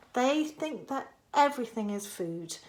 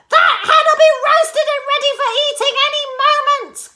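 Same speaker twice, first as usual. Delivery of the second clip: high, loud, fast gleeful shout; high loud old woman, fast; Grandma shouting; 12 dB louder, faster than usual. A narrator's reading. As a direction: crow over the hen as Grandma. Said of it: "Grandma shouted".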